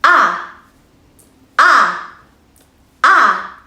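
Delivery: clipped open "ah", repeated; "ah" short and open, like a model for the class; short ah sound. Shown as a demonstration. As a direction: demonstrate the short "ah" slowly and clearly.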